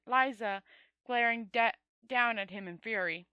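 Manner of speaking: ordinary delivery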